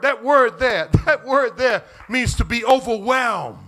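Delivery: loud, high preaching build; fiery preaching. Acted. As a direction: bring it home to the congregation. Preaching at full force.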